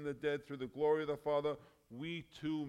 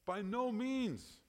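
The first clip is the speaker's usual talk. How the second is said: high, slow, forceful "By no means"; emphatic; 9 semitones higher, much slower than usual. Retold or imitated. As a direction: draw out every word of "By no means" with weight. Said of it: "Paul says this very emphatically".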